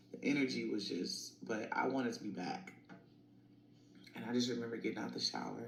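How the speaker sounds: ordinary talk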